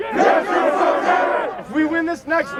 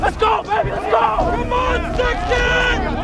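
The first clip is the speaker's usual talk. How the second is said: yelling at a horse race; 7 semitones higher, slower than usual. Real, not acted. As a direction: urge them on at the top of your lungs. Drill sergeant cheering on a race.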